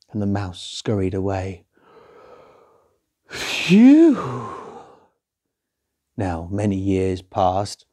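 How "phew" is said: very high, loud sigh of relief; relieved phew. Acted. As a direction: breathe out in squeaky relief. Mouse relieved after escape.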